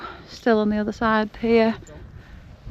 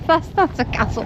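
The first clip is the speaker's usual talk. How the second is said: laughing; 7 semitones higher than usual. Real, not acted. Laughing disbelief at ruin.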